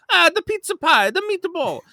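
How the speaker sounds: high, sing-song Italian accent; goofy Italian accent. Shown as a demonstration. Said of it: "You do a goofy Italian accent".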